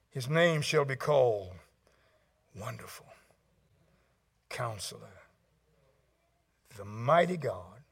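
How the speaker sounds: low, soft, reverent naming; hushed scripture recital. Acted. Pastor reciting His names to the congregation, low and hushed. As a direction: let each title stand alone, quietly.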